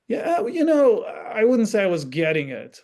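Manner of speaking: put-on a sudden high, loud teen voice; playing the kid brushing it off; mock kid reply. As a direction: jump up into a defensive kid's voice. Parent voices upset kid's reply.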